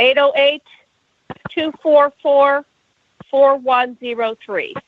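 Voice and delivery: slow, careful digits for writing; slowly. Shown as a demonstration. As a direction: spell out each digit slowly. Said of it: "repeat that again and slowly".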